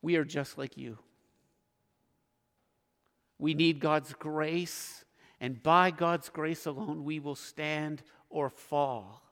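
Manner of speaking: slow, quiet sincere confession; hushed humble admission; humble, soft and slow. Real, not acted. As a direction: let each phrase sink in softly.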